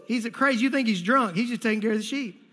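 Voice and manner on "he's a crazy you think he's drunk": normal talking voice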